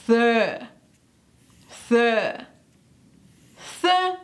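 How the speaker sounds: "Th" with tongue between the teeth, air blown out; tongue out and blow. Shown as a demonstration. Teacher isolating the th sound. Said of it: "Saca la lengua y sopla".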